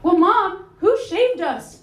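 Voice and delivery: put-on a curious kid blurting a question; daughter crying out. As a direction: daughter asking mom in the car. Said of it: "she cries out".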